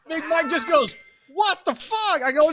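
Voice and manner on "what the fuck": very high incredulous yelp; hammed-up shocked protest; mock outrage. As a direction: yelp in acted disbelief. Acts outrage at dad.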